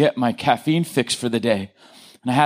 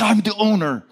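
higher, indignant protest to the screen; indignant retort; 6 semitones higher than usual. Retold or imitated. Speaker arguing back at the iPad error message, indignant and higher. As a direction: insist on it, offended. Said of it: "I'm like".

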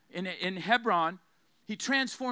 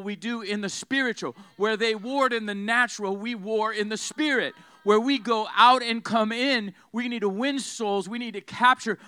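forceful, driving sermon delivery; full-force preaching; 3 semitones higher, 6 dB louder than usual. Real, not acted. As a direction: drive each line home to the congregation.

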